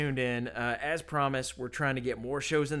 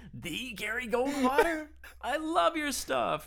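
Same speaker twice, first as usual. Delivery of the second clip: much higher, slow gushing squeal; starstruck fan; 11 semitones higher than usual. Pretend. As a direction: gush like you've met your hero. Gushing at a pretend famous lawyer.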